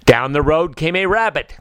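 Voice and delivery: plain reading voice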